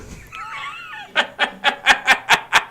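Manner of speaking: shrill giggle soaring up; high squealing laugh. Real, not acted. Streamer cracking up at his own joke, squealing laugh.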